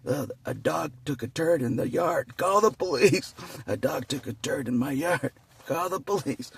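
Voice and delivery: loud, high hammed-up complaint; fussy homeowner yelling about a turd, hammed up; mock outraged caller. Mocking caller over dog turd.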